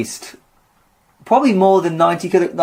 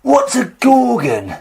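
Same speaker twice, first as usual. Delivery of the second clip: slow, higher put-on London heavy; rhyming hardman; 6 semitones higher, slower than usual. Atlas stumped by Gorgon, a slow put-on London heavy. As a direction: bark it slowly like a London thug. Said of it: "Atlas talks in rhyme".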